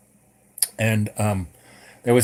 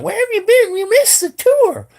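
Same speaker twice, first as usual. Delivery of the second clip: high, loud mimicry of women complaining; mock complaining girls; 6 dB louder than usual. Girls confront him after the tour, mock complaining in high voices. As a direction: put on a shrill-pitched, loud scolding girls' voice.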